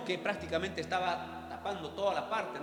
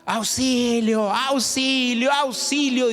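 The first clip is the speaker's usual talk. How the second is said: loud drawn-out cries for help, higher; child crying for help; 5 semitones higher, 3 dB louder, much slower than usual. Retold or imitated. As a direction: call out slowly like a trapped little girl. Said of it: "la niña en la ventana decían que gritaba". (grito de auxilio alargado y agudo)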